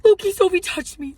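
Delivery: high, loud starstruck squeal; excited squeal. Retold or imitated. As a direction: gush to your friend.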